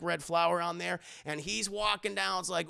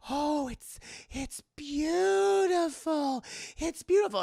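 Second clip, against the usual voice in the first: much higher, slow, drawn-out awe; swooning Willie; 8 semitones higher, slower than usual. Retold or imitated. Willie gazing at the diamond, high and swooning. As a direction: melt over the diamond.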